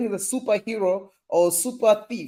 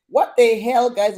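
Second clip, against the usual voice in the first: mock outrage; 4 semitones higher, 7 dB louder than usual. Mock outrage about a bag.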